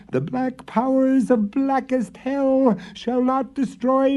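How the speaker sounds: very high, slow, loud shrieking hag; witch screech. A narrator's reading. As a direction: screech the curse from the flames. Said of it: "they heard her screech".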